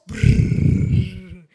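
loud lip-trill of blowing wind; drawn-out brrrr like strong wind; imitated gusting wind. Shown as a demonstration. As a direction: make the sound of a buffeting wind.

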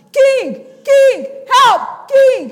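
high, full-voiced desperate calls; shouting for help. Hungry woman shouts to king walking by, urgent shouting. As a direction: shout each word to get the king's attention. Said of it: "a woman sees him and starts shouting".

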